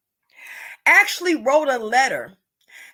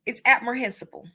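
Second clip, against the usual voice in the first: ordinary delivery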